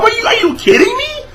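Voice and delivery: very high, fast squeal of disbelief; squeaky, rapid-fire indignation; incredulous anger. Real, not acted. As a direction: blurt it out high and outraged. Angry reply to the catchphrase.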